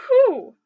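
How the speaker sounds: big loud high exhale of relief; loud whew. Real, not acted. Recovering after the sad book, loud whew. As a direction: blow it out after tears.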